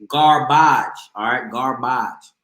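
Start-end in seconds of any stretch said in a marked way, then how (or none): 0.1-0.9 "garbage" as gar-BAHZH, low; "garbage" rhyming with "mirage", pitched down; French-style garbage. Shown as a demonstration. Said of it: "it's accent over the E". 1.5-2.3 "Garbage" as gar-BAHZH, low and softer; "Garbage" rhyming with "mirage", dropped low and quieter; French-style garbage. Shown as a demonstration. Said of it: "it's accent over the E".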